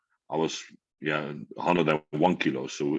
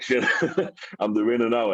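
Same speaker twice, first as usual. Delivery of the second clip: surprised laughing; 6 semitones higher than usual. Retold or imitated. Realizing he won.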